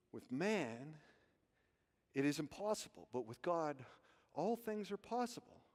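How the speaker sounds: soft, low voice with pauses; inside voice. Shown as a demonstration. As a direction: murmur it as a private thought. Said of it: "inside voice".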